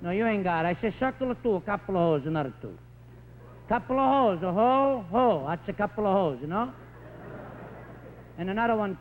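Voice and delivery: put-on a light Italian-American accent, shouting into the phone; an Italian father calling the county office with a light Italian accent; Italian immigrant on phone. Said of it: "it sounds like this".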